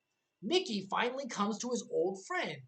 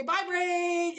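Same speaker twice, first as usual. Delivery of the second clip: high, bright mock send-off, louder; cheery farewell shout; 9 semitones higher, 5 dB louder than usual. Viewer leads everyone in saying bye to the beaten boss, cheery call. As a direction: wave the boss off with a sing-song bye. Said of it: "Everyone say".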